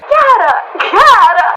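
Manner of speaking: put-on loud, high, drawn-out Italian accent. Italian stranger delighted by the tourist's Italian name, Italian accent. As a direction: savour the name in hammy, slow Italian. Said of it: "Chiara is an Italian name".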